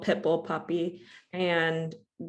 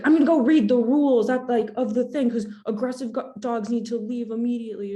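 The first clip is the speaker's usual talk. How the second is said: loud, raised, ranting threat; imitated angry man; 5 semitones higher, 6 dB louder than usual. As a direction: rant at her like an angry dog owner. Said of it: "He's like".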